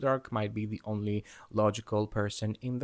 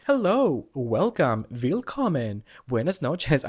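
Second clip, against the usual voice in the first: dollhouse voice greeting; 3 semitones higher, 8 dB louder than usual. Retold or imitated. Dollhouse voice welcoming visitors, loud mock greeting. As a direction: play the creepy dollhouse voice, loud and sing-song. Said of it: "a voice that says".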